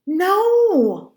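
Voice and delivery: high, loud, furious refusal; long, angry yell; scream. A narrator's reading. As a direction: scream it and hold the vowel. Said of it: "I screamed".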